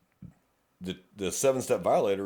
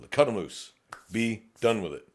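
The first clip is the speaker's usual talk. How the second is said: ordinary talk, nothing marked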